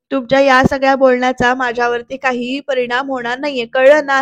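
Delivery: usual speaking voice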